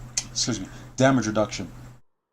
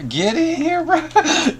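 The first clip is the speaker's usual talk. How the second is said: much higher, squeaky playful call; 3 dB louder, much slower than usual. Pretend. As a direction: squeak it out teasingly. Calling a friend into chat.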